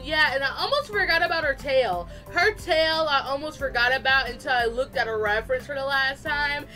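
ordinary talk, nothing marked

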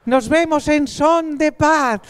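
slow, high alien-greeting voice; talking to aliens. Pretend. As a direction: greet Martians with exaggerated slowness. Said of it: "hablando para extraterrestres".

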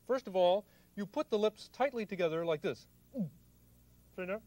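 ordinary delivery

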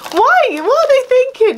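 very high, laughing outrage; incredulous. Real, not acted. Another ugly blouse, incredulous very high squeal. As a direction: squeal it in disbelief.

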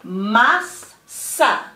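"mas" rising, pause, "sa" falling; teacherly syllable-by-syllable "mas, sa"; separate syllables. Shown as a demonstration. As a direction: say "mas, sa" as two distinct beats. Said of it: "Those two syllables together are".

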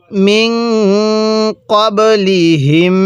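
মিন ক্বাবলিহিম with long humming nasal endings; measured model reading of মিন ক্বাবলিহিম; slow tajweed recitation. Shown as a demonstration. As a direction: hold the n and final m of মিন ক্বাবলিহিম. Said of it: "কিভাবে পড়ব?".

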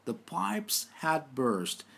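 very slow repeat; deliberate teacher repeat; slow dictation. Shown as a demonstration. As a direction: say it slowly for the class to copy. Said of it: "Tekrar edelim".

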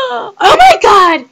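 overjoyed scream of discovery; excited gasp and shout. Real, not acted. Gamer finding the hidden item, loud joyful shout. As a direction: gasp high, then a loud crying shout. Finds hidden ballet bar.

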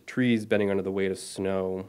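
usual speaking voice

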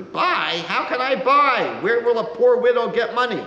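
a poor widow protesting she has no money; complaining bitterly in a high old voice; bitter complaint. A narrator's reading. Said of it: "She complains bitterly".

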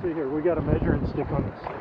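normal talking voice

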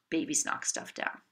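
low, half-whispered shrug; resigned child. Shown as a demonstration. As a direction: mutter it like a kid accepting it. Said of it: "to something which is like".

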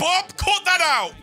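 high, loud, slow yell; shouting at friend. Real, not acted. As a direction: call him out, loud and drawn. Yelling at friend in game.

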